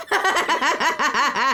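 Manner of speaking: high squealing laugh through words; helpless laughter; laughing hard. Real, not acted. Hosts crack up again.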